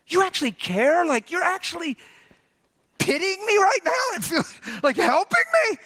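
very high, incredulous mock-weepy voice. Hunter asking if the Lord is helping, retold weepy and high. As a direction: climb into a high, overwhelmed question. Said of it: "I was weepy".

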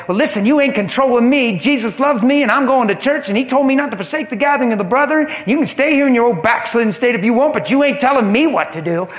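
loud, fast, mock-defiant comeback; hammed-up wife telling her husband off; loud and fast imitation of a wrong reply. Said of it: "The last thing you need to do is say".

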